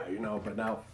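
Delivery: whispered aside, unvoiced. Real, not acted. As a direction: breathe it out as an aside.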